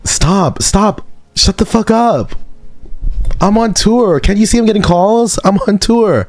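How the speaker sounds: very high mock-angry singer voice; very high mock pop-star tantrum. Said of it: "giving The Weeknd acting".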